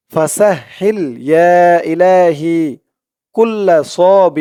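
slow Arabic recitation with every sound articulated; careful recitation. Shown as a demonstration. Teacher modelling pronunciation for viewers, slow careful chant. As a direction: model each Arabic sound exactly for copying. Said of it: "আমি যেরকম করে উচ্চারণ করতেছি".

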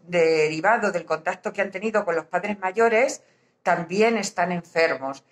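usual speaking voice

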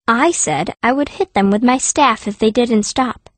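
ordinary delivery, nothing marked

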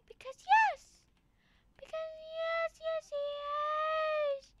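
very high, drawn-out squeaky voice; high-pitched playful insistence; silly squeal. Pretend. Silly answer to own question.

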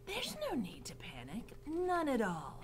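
breathy, half-whispered reassurance; Roscuro. A narrator's reading. Rat reassuring Mig, breathy soothing voice. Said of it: "said Roscuro".

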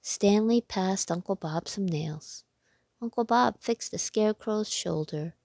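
ordinary delivery

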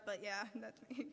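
light laugh breaking into the words; amused chuckle. Real, not acted. Speaker joking to congregation about own childhood picture, amused chuckle. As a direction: say it through a small, self-mocking laugh.

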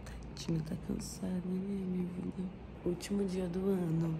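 very soft, hushed, tender good morning. Real, not acted. Whispering good morning beside her sleeping partner, gently hushed. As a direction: murmur so the sleeper isn't startled.

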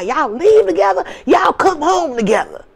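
high, tearful scold; mother's tearful scolding. Retold or imitated. Mother scolding daughter after the scare, tearful and high. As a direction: plead it through tears like a scared mom.

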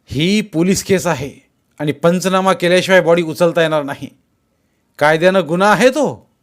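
loud, firm statement of the law; official authority. A narrator's reading. The collector in Jaydeep explains the law, loud and authoritative. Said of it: "Jaydeep मधला जिल्हाधिकारी म्हणाला".

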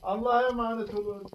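sleepy soft farewell; drowsy mumbled goodbye; nodding off. Real, not acted. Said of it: "Benim iyice uykum geldi".

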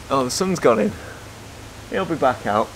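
ordinary talk

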